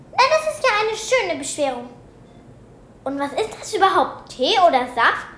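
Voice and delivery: mock the mess sharply; sarcastic scolding. A narrator's reading. Teacher holds up the soaked notebook, loud and sarcastic.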